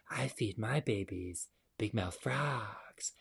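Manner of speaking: slow, soft hissing whisper; sly snake whisper. Acted. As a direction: whisper it like a snake luring prey. Snake answers menacingly.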